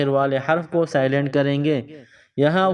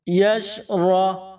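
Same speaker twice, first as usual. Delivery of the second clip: یش را drawn out and joined slowly; slow joined syllables; 4 semitones higher, much slower than usual. Shown as a demonstration. Teacher joining syllables for pupils. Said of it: "پیچھے سے ملائیں گے".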